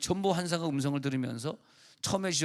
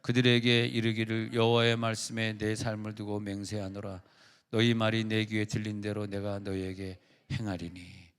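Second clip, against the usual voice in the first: slow, weighty reading of God's words; solemn scripture reading; 4 semitones lower than usual. Acted. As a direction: intone the verse slowly like a pronouncement. Reads God's oath from Numbers. (하나님의 말씀을 천천히 엄숙하게 낭독)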